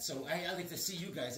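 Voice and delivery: usual speaking voice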